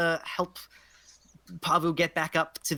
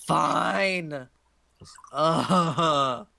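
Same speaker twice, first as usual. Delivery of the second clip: drawn-out, groaning "fine"; reluctant, heaving agreement; grudging. Acted. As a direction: give in with an exasperated groan. Hag reluctantly agrees to guest.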